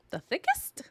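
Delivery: high, loud disbelieving "thickest"; incredulous. Real, not acted. Host doubting a viewer's claim about Mary, incredulous.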